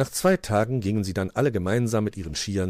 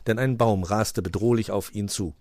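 ordinary delivery, nothing marked

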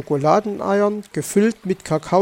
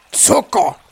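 higher, startled single word; tasting with dismay; 6 semitones higher, 4 dB louder than usual. A narrator's reading. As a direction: react to an unexpected taste. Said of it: "schmeckte er". (überrascht, mit Abscheu)

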